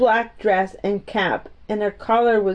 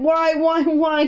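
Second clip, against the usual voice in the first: alarmed; 7 semitones higher, slower than usual. A narrator's reading. Mary turns pale, alarmed.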